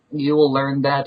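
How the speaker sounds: ordinary delivery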